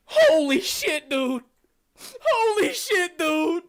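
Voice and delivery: high, loud, slow "holy shit, dude"; amazed shout. Acted. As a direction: blown away by the solo. Joey amazed by harmonica.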